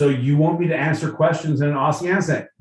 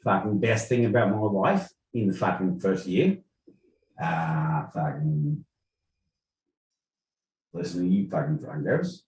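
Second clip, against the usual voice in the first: put-on Aussie accent; 6 semitones lower, 6 dB quieter than usual. Guest tells host his year's best thing, Aussie accent. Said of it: "To answer in an Aussie accent".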